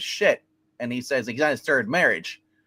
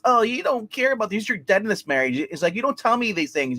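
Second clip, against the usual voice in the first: higher-pitched mock complaint; acted-out marital grievance, pitched up; retold nagging wife; 7 semitones higher than usual. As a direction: imitate a wife nagging her husband. Voicing wife's complaint in marital quarrel.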